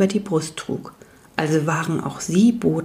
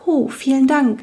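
higher, timid thankful voice; shy will-o'-wisp; 7 semitones higher than usual. Acted. Will-o'-wisp thanks the messengers, lightly voiced shy tone. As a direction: thank them a bit bashfully. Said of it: "trat schüchtern näher".

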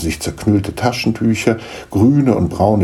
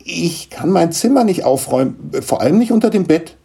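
high, urgent protest; agitated; 11 semitones higher than usual. Acted. Niklas explains to his mother why he can't tidy, agitated. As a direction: blurt it out, flustered. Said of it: "rief Niklas aufgeregt".

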